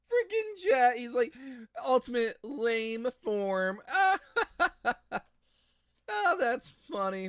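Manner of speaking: high, loud laughing squeal; cracking up; laughing hard. Real, not acted.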